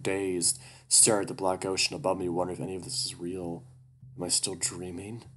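ordinary delivery

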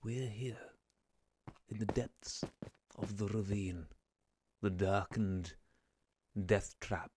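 hushed, low documentary narrator; soft, halting nature-film narration, spaced out for suspense; David Attenborough. Acted. Narrator introducing viewers to the ravine, in an Attenborough impression. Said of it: "I wanna go ready David Attenborough".

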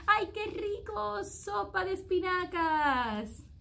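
high happy yum; delighted exclamation. A narrator's reading. Child delighted by soup.

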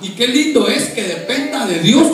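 loud, high, impassioned pulpit delivery; full-force preaching. Real, not acted. Preacher urging the congregation to serve God, impassioned preaching. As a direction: build to a drawn-out, crying-out climax.

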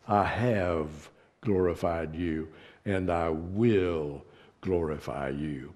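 low, slow, solemn divine voice; a hint of God speaking from heaven; voice of God the Father. Preacher voicing the Father to his Son, low and solemn. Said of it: "the Father speaking out loud says".

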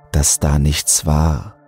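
ordinary delivery, nothing marked

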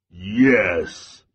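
low, loud bear growl. A narrator's reading. Bear growls at the pinned squirrel, low. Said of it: "Bear growled".

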